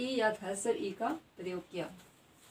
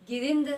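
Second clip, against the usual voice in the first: गिरिंद punched louder on the short "rin"; teacher's forceful demo of गिरिंद; stressed syllable; 3 semitones higher, 7 dB louder than usual. Shown as a demonstration. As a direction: hit the middle of गिरिंद hard. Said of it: "उच्चारण करते समय जोर".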